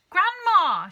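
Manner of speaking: very high and loud shriek. Acted. Wife shrieking at shrinking grandma, very high and loud. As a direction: shriek her name. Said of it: "shrieked Missus Cranky".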